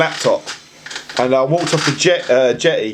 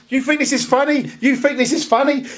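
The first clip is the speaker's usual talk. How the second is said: mock angry accusation; 7 semitones higher than usual. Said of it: "he goes".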